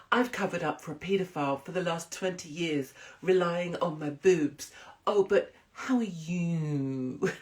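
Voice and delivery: syrupy daytime-telly sweetness; Holly impression. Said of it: "give us a Holly impression".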